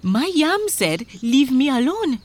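high, urgent complaint; worked-up farmer's recital; agitated farmer. Acted. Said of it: "began to recite their troubles".

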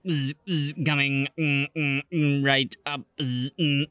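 glitchy robot, humming and sputtering; old rusty robot. Acted. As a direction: buzz through it like a knackered droid. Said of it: "said the knackered robot".

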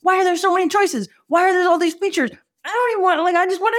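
high, frustrated plea; mock desperate shopper; exasperated. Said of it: "And I'm like".

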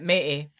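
matey said low with the T dropped, "mee"; Scottish glottal matey, no T sound; Scottish matey without T. Shown as a demonstration. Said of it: "That's how I would say it".

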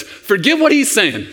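imitating an earnest kid; pleading little boy. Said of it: "And the little boy says".

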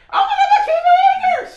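very high, loud outburst; imitated screaming. Old rebbe wakes his dozing student, sudden high imitated scream. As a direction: scream it suddenly at the top of your pitch, imitating. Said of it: "And he's screaming".

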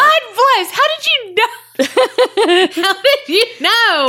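high, laughing delight; laughing squeal. Real, not acted. Laughing at a shared joke.

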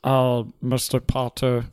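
villain impression. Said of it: "Can you guys do the villain impression?".

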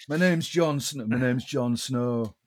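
slow, put-on brooding hero voice. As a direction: drag it out in character. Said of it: "So if Jon Snow was [name]".

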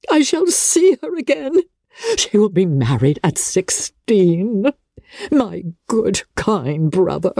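high, gushing, fluttering mother; a silly mother beside herself with delight; giddy mother rattling off wedding plans; Mrs Bennet, overjoyed. A narrator's reading. Narrator voices Mrs Bennet.

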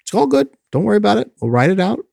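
calm them down brightly; reassuring. Retold or imitated. Helper reassuring a scared client on the bus, high and soothing. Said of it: "I'd say".

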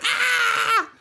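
very high, thin held scream; piercing pretend scream, not full force; mock scream. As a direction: imitate a fleeing victim's shriek. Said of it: "then she's like".

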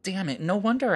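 high, playful curse; mock frustration. Real, not acted. Reacting to news that accents stop developing, mock frustrated. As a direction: throw up your hands in mock dismay.

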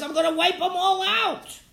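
high, emphatic acted threat; dramatized divine warning. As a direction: threaten with pitch pushed way up.

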